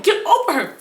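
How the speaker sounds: high retold command; urgent order. Friend ordering boyfriend off girl, high mock urgency.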